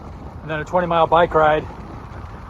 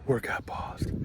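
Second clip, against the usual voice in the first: fitness app coach; 4 semitones lower, 11 dB quieter, slower than usual. Retold or imitated. Repeating the fitness app prompt.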